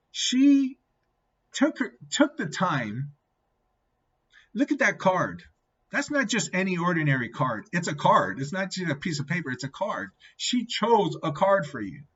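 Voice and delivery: high, animated retold pep talk; acting out an insistent friend; emphatic urging. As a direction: make him see it, hammering each point. Friend urging him to see hope.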